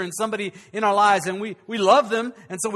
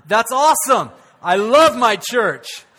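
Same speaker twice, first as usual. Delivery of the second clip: mock delight; 9 dB louder, slower than usual. Real, not acted. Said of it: "joke to congregation".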